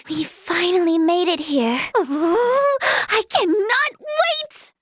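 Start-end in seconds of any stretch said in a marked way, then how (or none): 2.0-4.7 high, drawn-out squeal of excitement; barely contain it, stretching the words; giddy anticipation. Acted. Thrilled on finally arriving.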